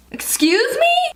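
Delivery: very high, drawn-out indignation; exaggerated offended question; mock affront. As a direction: squeal it like you're scandalized. Mock outrage at a door handle.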